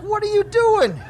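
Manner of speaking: put-on high, startled call after a runaway kid; yelled at nephew. Uncle calls to nephew running off pantless, high alarmed shout. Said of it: "I'm like".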